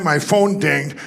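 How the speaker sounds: normal talking voice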